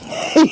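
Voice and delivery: high, loud laugh; real laughing fit; burst of laughter. Real, not acted. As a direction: crack up at the answer. Laughing at player answer.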